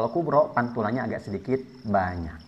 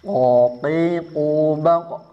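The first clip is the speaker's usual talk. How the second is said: slow, sustained recitation drill on a level pitch; praktek tadribat; 4 dB louder, much slower than usual. Shown as a demonstration. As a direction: model each syllable for the class to repeat, drawn out. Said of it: "Ya, ulangi". (contoh bacaan panjang untuk diikuti)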